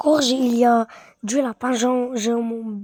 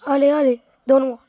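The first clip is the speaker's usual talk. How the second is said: loud urging; 2 semitones higher, 8 dB louder, much faster than usual. Real, not acted. Urging the game on.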